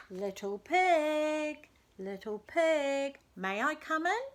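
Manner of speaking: light sing-song slow call; lightly voiced kind wolf, slow; friendly wolf asking. A narrator's reading. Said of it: "asked the wolf".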